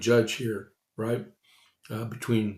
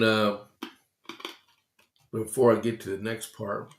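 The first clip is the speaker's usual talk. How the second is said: ordinary delivery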